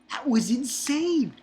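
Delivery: high, slow, amazed; excited. Real, not acted. Reviewer amazed by the chapter's action. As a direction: savour how insane it was.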